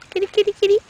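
high-pitched kitty call; quick repeated high pet call; calling the cat. Real, not acted. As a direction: coax the cat over in a high voice.

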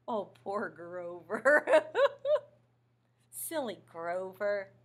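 chuckling through the words; amused at the puppet; laughing. Real, not acted. Reader laughs.

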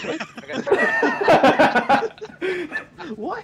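high laughing "what?"; cracking up in disbelief. Real, not acted.

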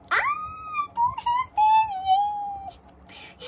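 high, loud, slow stretched scream of joy; ecstatic squeal. Real, not acted. Collector pulling a card she lacks, ecstatic squeal. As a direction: shriek with delight at a lucky find.

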